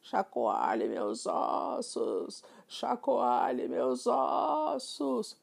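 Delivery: very slow, eerie drawn-out ghost moan; ghostly whisper. Acted. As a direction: moan it out slowly like a haunting spirit. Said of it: "em um tom sussurrante e fantasmagórico".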